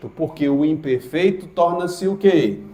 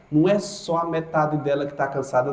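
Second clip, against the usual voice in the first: ordinary delivery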